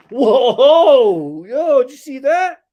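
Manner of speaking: loud startled whoa; startled by the dog; surprised shout. Real, not acted. Dog surprise mid-wrestle.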